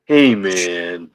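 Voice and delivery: low, laid-back stoner voice. Pretend. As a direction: drop it low like a stoned hippie. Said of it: "I can just imagine [name] and [name]".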